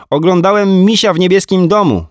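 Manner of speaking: put-on high, chirpy little boy; small boy reporting his cartoon to his mum; child voice. A narrator's reading. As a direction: tell it like a proud small kid. Small boy telling mum news.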